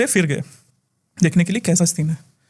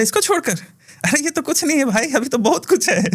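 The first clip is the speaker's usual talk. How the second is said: much higher taunting ghost voice; mocking spirit; 8 semitones higher than usual. Spirit taunts the healer who summoned a power, high and mocking. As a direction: scoff like a cocky spirit.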